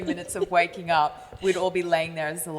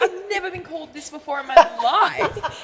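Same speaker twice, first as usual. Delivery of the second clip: higher, laughing protest; laughing disbelief; 8 semitones higher, 3 dB louder than usual. Retold or imitated. Telling friends about the new name, laughing protest. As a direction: laugh through the complaint.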